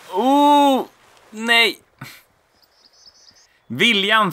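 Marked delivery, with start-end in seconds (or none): 0.1-1.8 high, loud cry of dismay. Real, not acted. Gamer missing the jump, high and loud oh no. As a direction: react to falling short.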